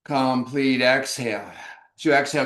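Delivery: normal talking voice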